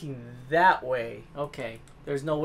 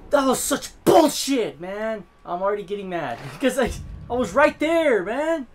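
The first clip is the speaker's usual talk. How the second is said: louder, higher angry complaint; frustrated rant; 5 semitones higher, 9 dB louder than usual. Real, not acted. Gamer ranting at the game after dying, angry. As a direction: vent loudly at the unfair game.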